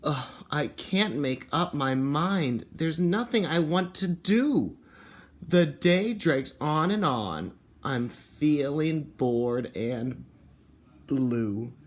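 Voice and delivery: mild mock-bored storybook voice; reading to children. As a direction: read it slowly to the kids, hamming up bored. Reads picture book aloud.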